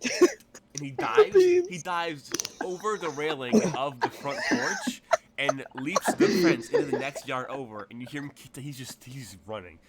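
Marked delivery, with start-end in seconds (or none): 0.0-7.9 laughing. Real, not acted. GM laughing while narrating.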